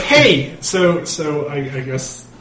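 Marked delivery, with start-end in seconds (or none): 0.0-0.6 shout. Acted. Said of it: "It's like".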